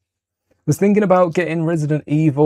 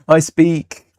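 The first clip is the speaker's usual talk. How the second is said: ordinary talk, nothing marked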